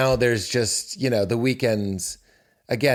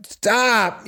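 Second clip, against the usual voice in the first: mock firm stop; 10 semitones higher than usual. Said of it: "I'm going to be like".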